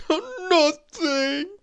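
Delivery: high, stammering mock sob; hammed-up tearful character voice; sobbing. A narrator's reading. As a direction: sob the word out in a high wail. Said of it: "he sobbed".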